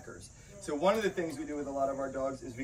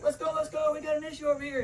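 much higher, urgent call with a strained edge; calling the dog; 11 semitones higher than usual. Retold or imitated. Handler in a bite-suit drill calling his dog for help, urgent high call. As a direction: shout for the dog while wrestling someone.

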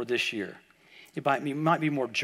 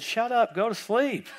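higher-pitched mock scolding; exasperated parent; 7 semitones higher, slower than usual. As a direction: retort like a parent woken at night, in imitation. Parents hushing kid at night.

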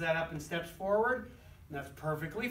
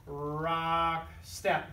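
drawn-out rock step. Shown as a demonstration. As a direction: stretch "rock" out long, then drop onto "step". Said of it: "we're not gonna go".

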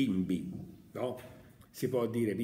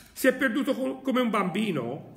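much higher, louder taunting voice; mocking bystander; 10 semitones higher, 4 dB louder than usual. A scoffing bystander mocks the madman, high and loud. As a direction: jeer at the madman from the crowd. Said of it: "fece un altro".

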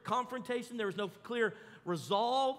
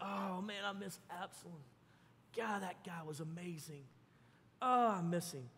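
wistful longing; 4 semitones lower, 7 dB quieter than usual. Acted. David longing for his son.

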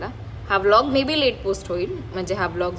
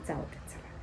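whispered aside; 15 dB quieter than usual. Real, not acted. Giving up aside.

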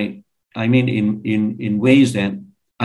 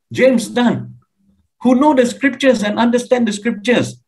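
incredulous preaching; 10 semitones higher than usual. Real, not acted. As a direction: preach it in pitched-up disbelief. Incredulous preaching about a theologian's claim.

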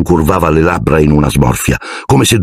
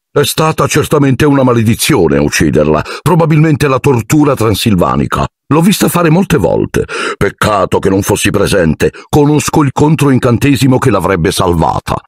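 high, airy show-off voice; pompous celebrity wizard; boastful Lockhart; 7 semitones higher than usual. Acted. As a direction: preen as a vain wizard who knows everything. Lockhart boasting over the cat.

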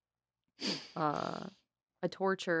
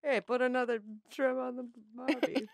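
laughing Australian accent, higher; sling it like an Aussie; 7 semitones higher, 5 dB quieter than usual. Shown as a demonstration. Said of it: "It's a classic".

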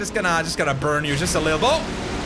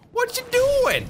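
squeaky mock voice. Mocking enemy in game.

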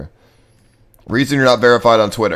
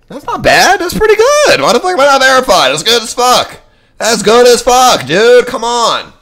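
high, loud, incredulous protest; indignant outcry; 12 semitones higher, 7 dB louder than usual. Real, not acted. Streamer protesting he is not verified, high indignant shouting. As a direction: plead your case at the top of your range.

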